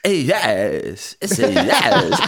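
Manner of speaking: slow, drawn-out actor impression rising high; Christopher Walken. Showing friends the actor's odd tone, exaggerated drawn-out impression. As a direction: stretch the words like a celebrity parody. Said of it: "what Christopher Walken you're talking about".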